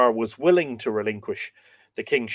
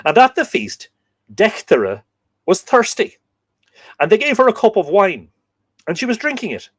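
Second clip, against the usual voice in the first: higher, louder dramatic storytelling; story narration; 7 semitones higher, 7 dB louder than usual. Acted. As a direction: read the myth aloud with high, bright energy. Reads the Lady Gregory story.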